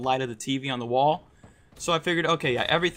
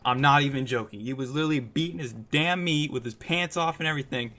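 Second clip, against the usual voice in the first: ordinary talk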